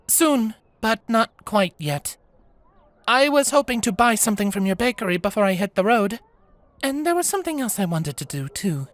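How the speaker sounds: put-on high, easy young woman's voice; young traveller. Tukey tells Diana her plans before leaving. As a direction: explain plans lightly, a bit mysterious.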